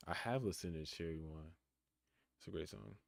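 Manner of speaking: ordinary talk, nothing marked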